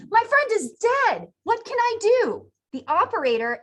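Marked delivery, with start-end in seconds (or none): 0.1-2.5 high, urgent plea, acted out; gasped panic. Hunter calling emergency services about his fallen friend, acted panic. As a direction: cry out louder in mock alarm. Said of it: "He gasps to the operator".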